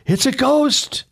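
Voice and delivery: high frightened voicing; mock terrified cry. A narrator's reading. Disciples seeing Jesus on water, high frightened cry. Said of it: "they cried out for fear".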